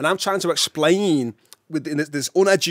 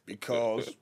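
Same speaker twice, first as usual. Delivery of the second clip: flat, low, matter-of-fact reply; 5 semitones lower, 11 dB quieter, slower than usual. Retold or imitated. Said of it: "And they're going".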